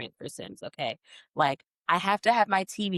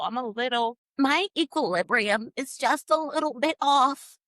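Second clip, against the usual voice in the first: higher-pitched silly voice; odd put-on voice; 6 semitones higher than usual. Podcaster telling listeners she feels off, odd put-on voice. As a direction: slip into a strange, high little voice. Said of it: "I don't even know what that voice was".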